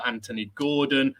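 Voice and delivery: ordinary talk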